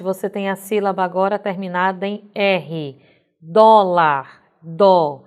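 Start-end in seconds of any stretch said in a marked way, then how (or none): 3.4-5.2 "Dólar" with a strong, louder DÓ, then "dó" alone; tônica em DÓ. Shown as a demonstration. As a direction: punch the DÓ of "Dólar", then isolate it. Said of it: "Sílaba tônica". (sílaba tônica forte em "Dólar": DÓ)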